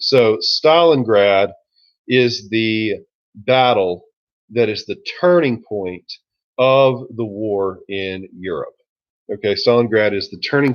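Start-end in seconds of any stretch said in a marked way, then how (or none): 0.0-8.7 slow repeat for notes. Shown as a demonstration. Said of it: "Yeah, I'm gonna repeat it".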